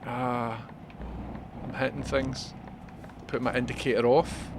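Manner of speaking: normal talking voice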